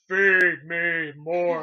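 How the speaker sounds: slow deep wrestler voice; deep Ryback growl. Retold or imitated. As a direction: drag it out, deep and heavy. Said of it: "'Cause she has a deep voice".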